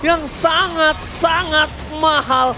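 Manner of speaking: loud hype play-by-play call; fighting game announcer. Acted. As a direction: shout the action like an esports caster. Caster hypes triple kill.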